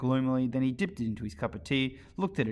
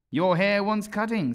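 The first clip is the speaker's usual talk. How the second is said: high, loud, slow; rude remark; 9 semitones higher, 8 dB louder, slower than usual. A narrator's reading. Hatter remarking rudely on Alice's hair, high and loud. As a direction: drop the rude remark on her.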